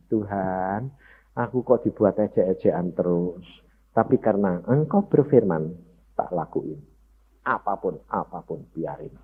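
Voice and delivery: low, soft surrendering prayer; hushed prayer at the feet of God; soft retold prayer. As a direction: pray it quietly, giving everything up. Voices his tearful childhood prayer.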